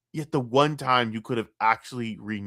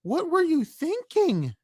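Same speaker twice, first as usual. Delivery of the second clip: much higher, slow, strained disbelief; high-pitched exasperation; slower than usual. Real, not acted. Streamer reacting to a Phil tattoo, high slow outraged squeal.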